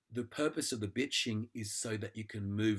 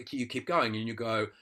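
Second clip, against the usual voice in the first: ordinary talk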